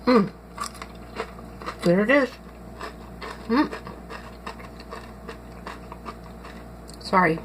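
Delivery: chewing while talking; mouth full. Real, not acted. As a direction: talk through a mouthful of chips. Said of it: "Talking with my mouth full".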